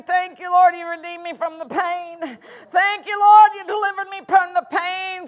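a worried person praying the problem through tears; mock desperate prayer. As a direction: play the anxious believer crying out about the pain. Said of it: "someone comes and says".

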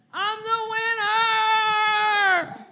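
Rocky at the top of the stairs, slow triumphant shout; Rocky's victory cry. Retold or imitated. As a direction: bellow it from the top of the steps. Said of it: "he says".

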